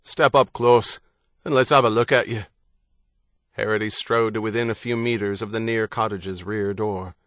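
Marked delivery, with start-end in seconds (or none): 0.0-2.4 louder, quick wary command; shouting. Acted. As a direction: bark it from inside the house. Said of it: "The man in the house shouted".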